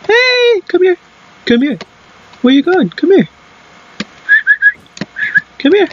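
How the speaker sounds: high, sing-song come here; creepy splicer call. Pretend. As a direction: lure them in like a creepy enemy. Imitating enemy splicer calling out.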